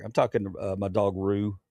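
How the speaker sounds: ordinary talk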